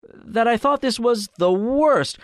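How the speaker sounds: very high, slow, strained protest; shaking voice. A narrator's reading. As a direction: push it high, loud and slow, nearly losing control. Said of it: "in a voice treacherously shaking".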